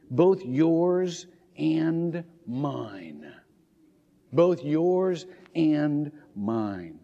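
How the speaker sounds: very slow, each word stretched out; slow, deliberate reading. Real, not acted. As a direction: let each word of the verse sink in. Savouring a quoted verse.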